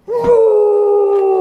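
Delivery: high, loud roar; dinosaur roar. Acted. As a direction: roar out big and proud.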